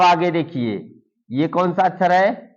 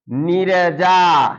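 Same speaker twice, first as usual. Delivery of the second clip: drawn out, slightly louder; slow read-aloud; 3 dB louder, much slower than usual. Shown as a demonstration. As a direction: sound it out slowly for the class.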